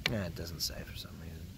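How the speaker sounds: fast muttered whisper; a quick whisper while reading the can; whispered aside. Real, not acted. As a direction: whisper it to yourself.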